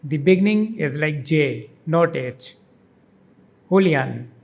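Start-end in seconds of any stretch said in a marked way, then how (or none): 3.7-4.3 Julian as hoo-lee-AHN, the last syllable hit; stress on the last syllable. Shown as a demonstration. Teacher shows where the stress falls in Julian. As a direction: Julian with an h sound and the weight on the final un. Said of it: "The emphasis is on the last syllable un".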